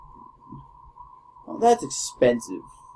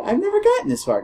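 excited squeal; 4 dB louder, faster than usual. Real, not acted. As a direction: pitch jumps high with joy. Thrilled at progress.

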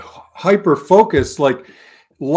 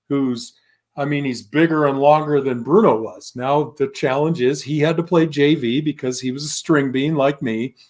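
ordinary talk, nothing marked

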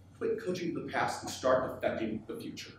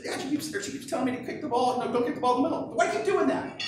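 higher-pitched imitation of a young girl; kid's voice, pitched up; nine-year-old daughter; 8 semitones higher than usual. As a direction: complain to Dad like a puzzled child. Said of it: "She said".

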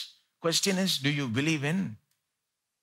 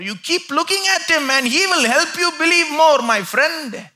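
loud, high preaching at full force; 12 semitones higher, 10 dB louder than usual. Acted. Preacher urging the congregation away from doubt, full force. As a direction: preach it out to the crowd.

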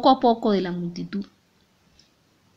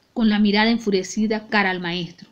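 plain reading voice

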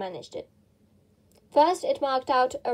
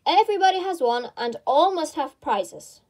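grand proclamation; 5 semitones higher, 5 dB louder than usual. A narrator's reading. Dodo's grand verdict.

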